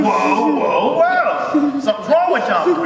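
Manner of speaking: high, loud amazed shouting; overwhelmed in the store aisle, shouting whoa; amazed exclaiming. Retold or imitated.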